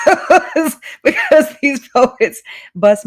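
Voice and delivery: loud, laughing, slow; real laughter, slow; laughing through words. Real, not acted. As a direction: laugh while admitting you're moved. Host moved, laughing.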